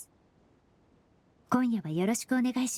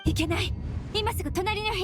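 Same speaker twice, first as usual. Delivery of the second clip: sudden alarm; 10 semitones higher than usual. Acted. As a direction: realize the danger and snap into action. 異変に気づき急ぐ. (はっと気づいて高く鋭く叫ぶ)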